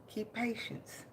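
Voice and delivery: soft low gentle message; angel's message. Retold or imitated. Relaying the angel's advice to followers, soft and low. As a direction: pass on the angel's words tenderly. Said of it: "the angel said".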